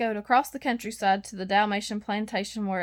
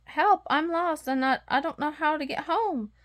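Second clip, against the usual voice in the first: frightened puppy; 6 semitones higher than usual. Acted. Lost puppy begging the cat for help. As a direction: blurt it out, scared and lost. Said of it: "Lucky cried in relief".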